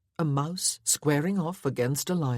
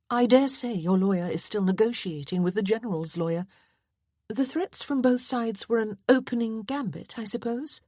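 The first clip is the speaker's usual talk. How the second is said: lightly voiced young lady, higher; a gentle lady probing, pitched higher; Miss Westcott's voice; 7 semitones higher than usual. A narrator's reading. Miss Westcott questions the colonel, lightly higher. Held female figure voice.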